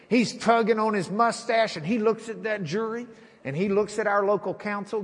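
ordinary talk

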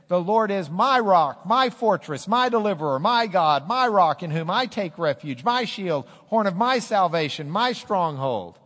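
loud, high proclamation of the psalm; full-force preaching. Acted. Pastor declares David's psalm to the church, preaching loud. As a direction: proclaim every word to the back row.

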